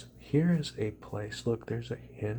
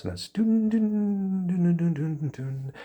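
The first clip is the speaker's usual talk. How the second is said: thump out a falling rhythm; pulsing dun sounds winding down; dun dun effect; 8 semitones higher, 6 dB louder than usual. Shown as a demonstration.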